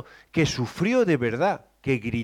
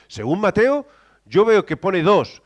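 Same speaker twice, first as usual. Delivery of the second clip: usual speaking voice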